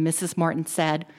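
ordinary delivery, nothing marked

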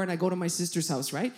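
ordinary talk

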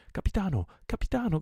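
high, loud urgent call; shouting. A narrator's reading. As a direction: call out loudly for the captain. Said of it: "gridò una voce". (grido acuto e concitato)

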